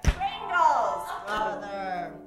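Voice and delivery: loud, emphatic Pringles; big stretched-out exclamation; punchline blurt, first take. Acted. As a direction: blurt out the answer with mock drama. Acted answer to chip question.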